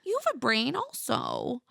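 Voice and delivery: high, cooing mock sweetness; exaggerated patronizing pity; condescending. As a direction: talk down to them in a high pitch. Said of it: "condescendingly adds to Tara".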